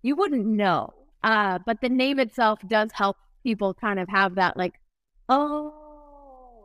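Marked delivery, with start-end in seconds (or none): 5.3-6.6 long, high, drawn-out oh; imagined realization. Retold or imitated. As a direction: stretch out a dawning oh.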